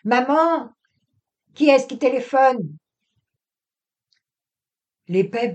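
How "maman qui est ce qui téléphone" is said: loud high call across the garden. Acted. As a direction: call out to mother from a distance. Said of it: "et d'appeler".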